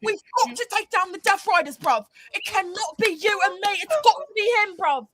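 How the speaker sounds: lightly voiced British wrestler promo; a hint of a heated British brawler; mock British wrestler. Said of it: "Ospreay and Swerve start scrapping".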